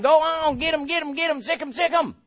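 high, excited urging; egging on. Retold or imitated. Prayers behind you urging you on, high and excited. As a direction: cheer them on like sicking a dog.

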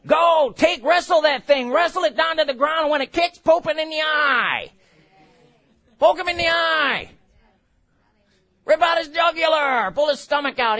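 shouted, high war cry; fierce battle prayer. Retold or imitated. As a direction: roar like a fighter in the ring. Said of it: "he's like".

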